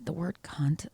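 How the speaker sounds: hushed, whispered swear word. Real, not acted. Podcaster mentioning a bad word, whispered. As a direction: drop the taboo word to a whisper.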